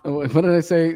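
ordinary talk

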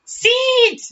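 very high, loud shriek; Nancy shriek. Retold or imitated. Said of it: "That sounds like Spanish".

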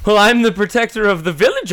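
loud, high protector's proclamation; weird character voice. Acted. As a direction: announce it high in an odd heroic voice. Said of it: "his voice is weird".

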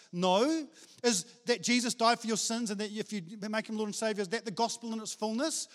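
normal talking voice

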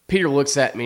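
usual speaking voice

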